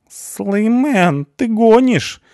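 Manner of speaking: panicked guy's voice cracking at the executioner; squeaky panicked shout; voice breaking. Acted. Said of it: "Голос говночела срывается".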